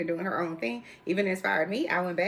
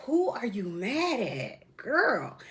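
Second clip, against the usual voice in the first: sassy quote; 4 semitones higher, 3 dB quieter, much slower than usual. Retold or imitated. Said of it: "and I'm just like".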